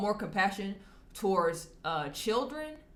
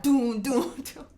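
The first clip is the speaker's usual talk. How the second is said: louder, mock dramatic doom sting; imitated sound effect; 5 semitones higher, 5 dB louder, slower than usual. Vlogger imitating his live stream sound bar, mock doom. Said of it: "I hit the sound bar with".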